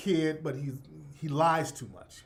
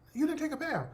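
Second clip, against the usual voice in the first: calling him out; 9 semitones higher, 5 dB quieter than usual. Retold or imitated. Uncle calls out nephew.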